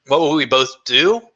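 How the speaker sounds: ordinary delivery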